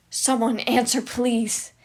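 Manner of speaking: loud desperate call. Acted. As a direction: pound the words out for help. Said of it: "he called".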